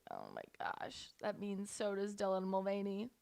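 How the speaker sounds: very low, hushed voice; hushed dread. Acted. Host dreading a lifetime alongside Dylan, hushed. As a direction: drop almost to a whisper in mock horror. Mock-dread realisation about a peer.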